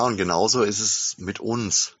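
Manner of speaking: normal talking voice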